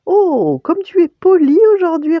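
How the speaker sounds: high, loud, smiling exclamation; maman ravie. A narrator's reading. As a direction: exclaim with a big smile, delighted. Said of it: "avec un large sourire". (exclamation aiguë, large sourire)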